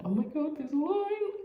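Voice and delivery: sudden high gasp of surprise; surprised. Real, not acted. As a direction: spot it and jump up high. Sees line appear.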